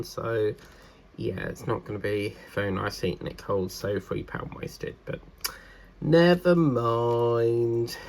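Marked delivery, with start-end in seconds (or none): 6.0-8.0 slow drawn-out never mind; resigned. Real, not acted. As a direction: let it go with a sigh. Resigned about wasted food.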